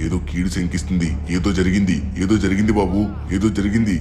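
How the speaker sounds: holy man crying out a dire omen; alarmed warning. Acted. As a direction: warn them that evil has struck. Baba foreboding, escalating.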